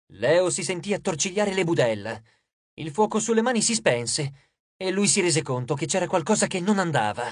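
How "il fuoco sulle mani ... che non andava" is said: plain reading voice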